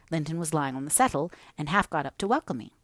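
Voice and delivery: ordinary delivery, nothing marked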